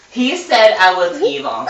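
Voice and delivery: Steve howling He said I was evil; howled. Acted. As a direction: wail it out like a howl. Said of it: "Steve howled".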